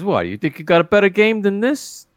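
lightly acted challenge, pitched high; mock-incredulous card-game bluff; Kirk. Retelling Kirk's bluff to gangsters.

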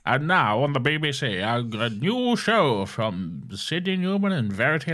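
mock vintage continuity announcer; hammed-up BBC programme introduction; old BBC announcer. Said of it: "I can only imagine that's how it went".

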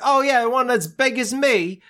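high-pitched imitation of a child; mock street kid. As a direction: pipe up like a little urchin. Voicing the boy in the street.

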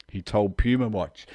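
normal talking voice

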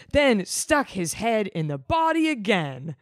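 usual speaking voice

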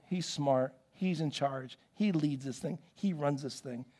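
low, soft admiring murmur; hushed admiration. Acted. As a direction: imitate admirers talking in hushed, impressed tones. Voices people admiring him behind back.

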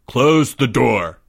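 slow, drawn-out order; commanding. A narrator's reading. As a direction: order it firmly, stretching the words. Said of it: "Greg commanded".

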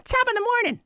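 very high, loud mock-Irish greeting; mimicking Shannon. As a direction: parody a bad stage-Irish catchphrase. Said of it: "never wanna hear Shannon say".

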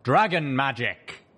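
loud, slow cryptic declaration; cryptic pronouncement. Acted. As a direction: proclaim it mysteriously. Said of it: "answering me cryptically".

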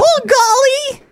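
high, strained cartoon anime voice. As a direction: squeak it out like an anime hero. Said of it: "Is that an anime voice?".

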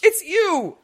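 very high, loud cry of joy; hammed-up happy tears; overjoyed stable man. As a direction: squeal it like a tearful, grateful fan. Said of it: "in tears".

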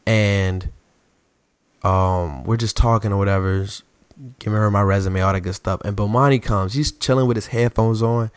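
ordinary talk, nothing marked

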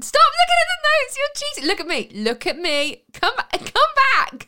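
high, teasing telling-off; playful scolding. Real, not acted. Scolding him for reading notes.

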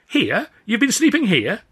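high, loud disbelieving question; can't believe it, pitch jumping up; incredulous. Acted. Steward Jeff aghast at the stowaway, high incredulous. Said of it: "said Jeff".

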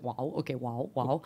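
put-on a light Italian immigrant accent on wow; a touch of an Italian accent; Mark's Italian accent. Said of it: "in his immigrant Italian accent".

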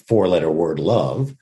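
low, matter-of-fact, no feeling added; objective, neutral. Shown as a demonstration. Actor demonstrating the word love without intent, objective. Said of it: "which is as an objective".

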